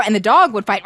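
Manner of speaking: plain reading voice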